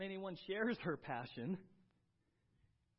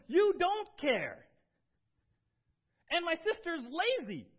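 shrill accusation; 11 semitones higher, 12 dB louder than usual. Retold or imitated. Martha complaining to Jesus about her lazy sister, loud mock outrage. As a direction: hurl it like a childish complaint. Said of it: "She comes and she says".

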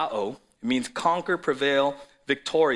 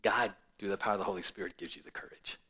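hushed; 5 semitones lower, 5 dB quieter, faster than usual. Acted. Drops to a hush.

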